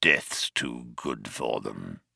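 very low, slow, soft menace; grim musing. A narrator's reading. Vogon captain alone closing his book, grim. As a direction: pronounce it darkly to yourself.

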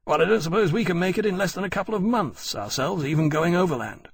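lively character voice, pressing the point; reckoning officer. A narrator's reading. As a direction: argue the estimate emphatically. Granby continues his estimate.

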